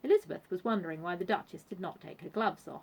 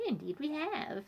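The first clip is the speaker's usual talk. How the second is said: lightly voiced genteel hostess, raised pitch; a hint of prim politeness, pitched up; polite Vanessa; 7 semitones higher than usual. A narrator's reading.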